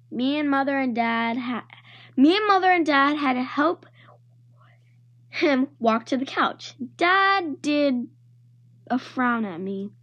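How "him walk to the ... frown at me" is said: usual speaking voice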